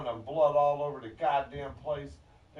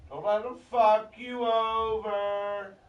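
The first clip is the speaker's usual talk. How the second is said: high, loud, long-held call; slow, raised-pitch holler; loud mock call; 8 semitones higher, 6 dB louder, slower than usual. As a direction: stretch it out and hold it high. Continues the loud mock chant.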